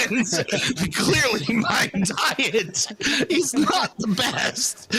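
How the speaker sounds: high and slow, stretched out; slow dry drag. Real, not acted. Guest jokes about his diet, slow. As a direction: draw it out for the joke. Self-deprecating drawn out joke.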